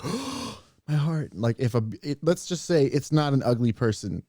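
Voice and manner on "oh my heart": mock swoon. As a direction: play love-struck at a voice. Acting out a swoon over accent.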